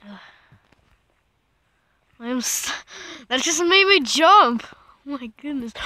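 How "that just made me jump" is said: sudden loud yelp of real fright; startled outburst. Real, not acted. Player jolted by a jump scare, startled yelp.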